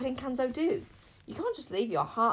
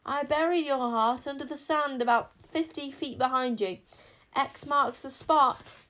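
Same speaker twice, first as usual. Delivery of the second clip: high, loud call to people below; raised, carrying shout; 5 semitones higher, 5 dB louder than usual. A narrator's reading. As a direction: call it out so they hear from afar. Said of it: "Bodkin shouted down to the parents".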